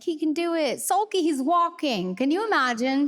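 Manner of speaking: high, thrilled retelling; amazed parent. Retold or imitated. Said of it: "And I was like".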